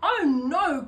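loud, high cry of alarm; cry of dismay. A narrator's reading. Witch finding her cat missing, loud high dismayed cry. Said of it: "cried Winnie".

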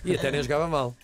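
ordinary talk, nothing marked

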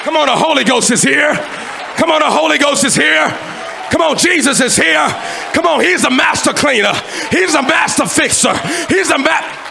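loud, high-pitched Pentecostal preaching; full-force preaching. Real, not acted. Preacher urging the congregation to praise, full-force shouted preaching. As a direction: shout it out to fire up the church.

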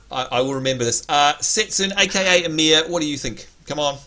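ordinary talk, nothing marked

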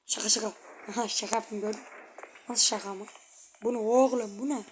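soft, low tense murmur; hushed voice. Real, not acted. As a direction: keep it down so no one hears. Hushed after grandma threat. (kısık sesle gergin)

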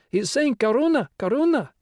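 put-on very high, loud child's voice; little girl. A narrator's reading. Little girl volunteering in class, high piping voice.

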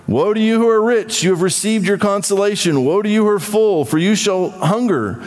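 forceful pulpit reading; scripture reading. Acted. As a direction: declaim the woes. Scripture read in sermon.

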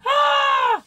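high, loud strained "Ah!"; loud cry of effort. Real, not acted. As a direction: shout it out from the exertion. Straining with a heavy box.

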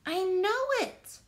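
high, eager character shout; excited shout. A narrator's reading. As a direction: blurt it out like a kid who knows. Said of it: "I shouted".

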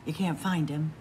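ordinary delivery

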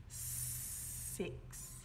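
usual speaking voice

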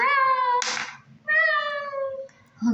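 high, drawn-out imitated meow; high cat meow. The cat answers, voiced by same speaker.

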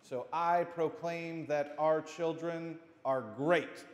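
put-on slow, loud declaration to the children; proclaiming. Shown as a demonstration. Children's sermon leader shows how to proclaim, loud and slow. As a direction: proclaim it like you mean it. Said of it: "You proclaim it".

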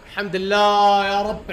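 loud slow thankful cry; loud slow relief; relieved shout. Real, not acted. Relief after winning fight.

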